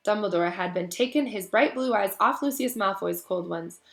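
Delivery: usual speaking voice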